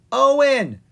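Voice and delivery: high, loud call for Owen; yelled. Acted. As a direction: shout for her missing brother. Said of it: "she yelled".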